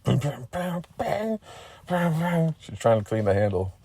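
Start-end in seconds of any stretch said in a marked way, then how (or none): 0.0-2.6 high metallic banging imitated by voice; clanking noise imitation. A narrator's reading. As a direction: mimic an axe clanking about. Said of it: "It was clanking around".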